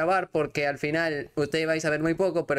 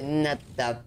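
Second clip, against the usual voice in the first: ordinary talk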